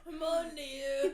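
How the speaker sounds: loud, laughing echo of a call-out; mock accusation. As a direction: playfully threaten through laughter.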